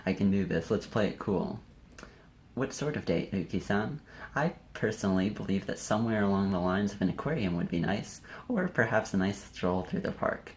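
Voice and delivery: ordinary talk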